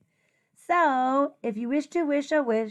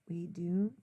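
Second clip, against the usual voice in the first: whisper; 6 semitones lower, 11 dB quieter than usual. Acted. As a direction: whisper it like a secret.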